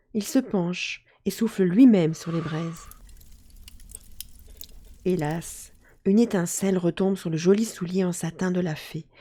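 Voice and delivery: usual speaking voice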